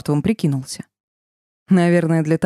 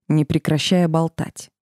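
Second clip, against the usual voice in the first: ordinary delivery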